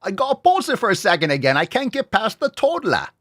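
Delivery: put-on British accent, incredulous. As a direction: ham up a British newsreader. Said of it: "I love accents".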